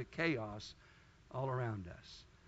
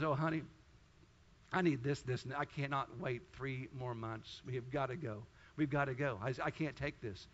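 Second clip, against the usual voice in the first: soft, lower mock plea of a desperate wife; retold pleading; 4 semitones lower, 6 dB quieter than usual. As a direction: hush it down like a wife begging to stay. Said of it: "she was begging, she was pleading, she was crying".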